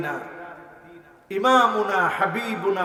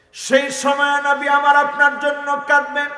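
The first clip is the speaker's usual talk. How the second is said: high, sustained sermon chant; impassioned chanting preacher; melodic preaching; 10 semitones higher, 7 dB louder than usual. Real, not acted. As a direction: intone each phrase high and let it ring.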